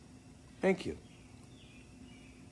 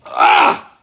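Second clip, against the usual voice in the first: imitated exclamation; 18 dB louder than usual. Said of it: "and go".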